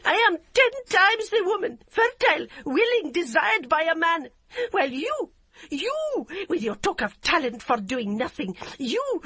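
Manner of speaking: very high, loud shriek. A narrator's reading. Yum shrieking at her mother-in-law while kicking, very high. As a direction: scream it hysterically. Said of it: "she shrieked".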